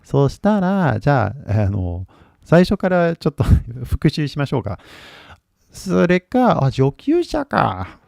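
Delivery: lightly voiced teacher sizing up the student's level; quick, half-playful check of the level, 上級者か lifted; imagined teacher questions. Talking to viewers, a mock teacher asking their level. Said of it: "I don't know what your level is".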